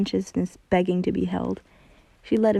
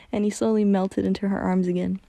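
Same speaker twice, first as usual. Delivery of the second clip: ordinary delivery, nothing marked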